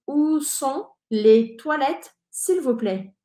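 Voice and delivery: "Où sont les toilettes, s'il vous plaît?" slowed, final T silent; slow model phrase. Shown as a demonstration. Teacher shows how the phrase is pronounced, slowly. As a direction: stretch out "Où" and go slowly. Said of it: "pronunciamos así".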